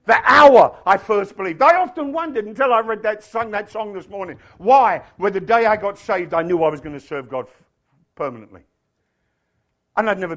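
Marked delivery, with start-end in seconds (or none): 0.1-8.6 loud, driving testimony; fired-up preacher testifying; full-force preaching. Real, not acted.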